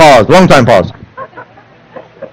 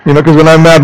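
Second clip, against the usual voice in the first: ordinary delivery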